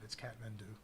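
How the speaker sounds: soft, lightly Irish "Kathmandu", TH turned to T; Irish accent. Shown as a demonstration. Joking about how Kathmandu can sound, a light Irish accent. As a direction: say "Kathmandu" as "Katmandu", a hint of Irish. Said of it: "say it with an Irish accent".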